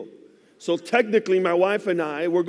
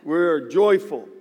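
slow emphatic; much slower than usual. Real, not acted. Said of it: "Absolutely not".

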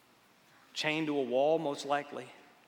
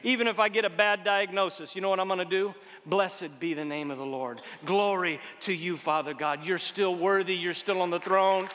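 loud, ringing sermon proclamation; full-force preaching; 5 semitones higher, 8 dB louder than usual. Acted.

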